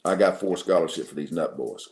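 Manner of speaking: low-pitched imitation of an old coach; deep-voiced coach. Old coach offering scholarships in the home, deep-voiced. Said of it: "says in that deep voice".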